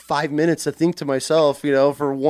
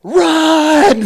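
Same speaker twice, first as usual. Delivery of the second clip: high, loud panicked shout; panicked yell; 12 semitones higher, 10 dB louder than usual. Retold or imitated. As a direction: scream it in sudden panic. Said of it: "I hear the panic in his voice".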